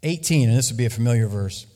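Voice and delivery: ordinary delivery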